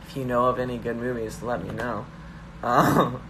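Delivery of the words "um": ordinary delivery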